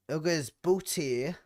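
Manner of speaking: ordinary talk, nothing marked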